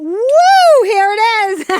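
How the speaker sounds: high, loud whoop of delight at the catch; excited whoop. Real, not acted. Host whoops after catching her whistle, excited and loud. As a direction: cheer as the whistle lands in hand.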